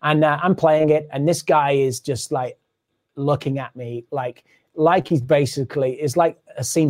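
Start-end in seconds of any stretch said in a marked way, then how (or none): none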